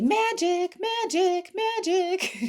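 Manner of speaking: high, sing-song chant of magic; singsong magic. Retold or imitated. Her delighted reaction whenever the universe delivers, high singsong. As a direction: lilt it up high like a delighted little spell. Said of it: "I would just say".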